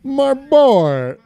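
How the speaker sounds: high, loud put-on voice; movie character voice. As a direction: call it out like the film character.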